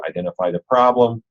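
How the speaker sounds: ordinary talk, nothing marked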